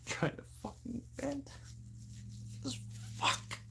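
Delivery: very quiet, high, choked voice; emotional, near tears. Real, not acted. Trying to vent to viewers, tearful and hushed. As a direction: barely get it out, swallowing tears. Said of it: "I'm fucking emotional right now".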